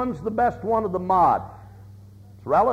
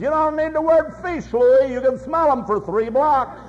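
put-on high, cheeky kid voice, louder on the name; smart aleck kid; 7 semitones higher, 4 dB louder than usual. Smart aleck kid teasing Louis about his fish, high and cheeky. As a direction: sass the old man like a know-it-all kid.

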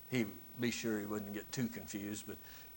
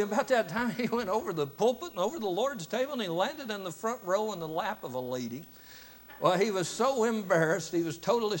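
excited telling; 8 semitones higher, 5 dB louder than usual. Real, not acted. Excited storytelling of the fall.

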